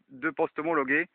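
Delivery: usual speaking voice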